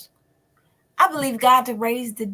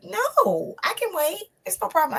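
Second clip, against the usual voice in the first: lady's voice pitched well higher, kindly insisting; sweet insisting lady; 11 semitones higher than usual. Retold or imitated. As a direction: lift the voice high like a polite lady who won't budge. Kind lady insisting in line.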